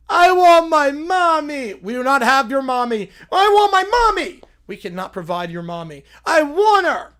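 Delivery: put-on little kid loudly whining at the Borg for her mommy. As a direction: wail for mommy like a toddler. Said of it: "They whine a lot".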